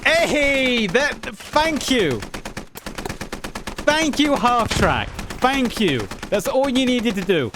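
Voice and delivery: loud, high, overjoyed thank you; excited shout. Real, not acted. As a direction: cheer the half track driver with big relief. Thanking half track driver, overjoyed.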